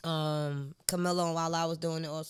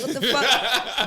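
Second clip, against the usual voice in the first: laughing mock outrage; 5 semitones higher, 7 dB louder, much slower than usual. Mock reaction in retold scene.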